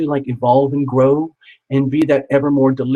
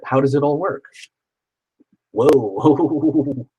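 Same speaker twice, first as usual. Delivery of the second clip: usual speaking voice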